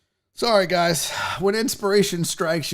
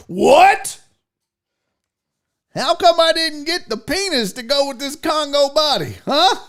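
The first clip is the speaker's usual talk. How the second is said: loud, high, incredulous protest; mock outrage; 7 semitones higher, 6 dB louder than usual. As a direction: act the friend's indignant disbelief. Acts friend's outraged reaction.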